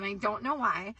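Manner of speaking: normal talking voice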